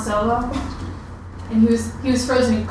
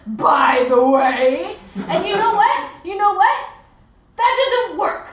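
higher-pitched, exasperated telling-off; indignant scolding; 4 semitones higher than usual. Acted. Partner rejects the Harry Potter role-play, exasperated. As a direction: tell them off, worked up and emphatic.

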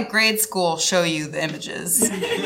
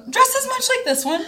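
high voice. Acted. Showing the dress on stage.

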